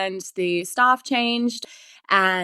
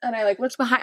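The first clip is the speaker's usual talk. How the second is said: ordinary delivery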